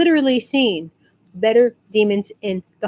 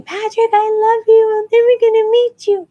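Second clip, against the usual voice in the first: screaming fangirl; 11 semitones higher, 4 dB louder than usual. Retold or imitated. Teen fan to the passing actor, mock screaming. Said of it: "the girl's still screaming".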